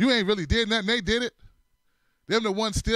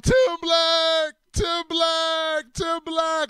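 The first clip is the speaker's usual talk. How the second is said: mock pleading cries; 9 semitones higher, 5 dB louder, much slower than usual. Friend crying out on ground.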